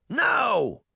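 high, loud group-style refusal; sharp high yell; shouted. A narrator's reading. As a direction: shout it like an outraged crowd. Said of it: "everyone in the line shouted".

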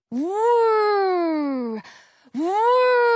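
very loud, high whirring; intense whir. A narrator's reading. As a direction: whir at full blast. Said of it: "The blender sound intensifies".

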